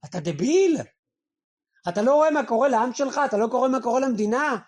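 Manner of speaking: loud, exasperated rebuke; mock scolding. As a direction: berate him like a fool. People scold Pharaoh. (נזיפה רועמת ומתלהמת)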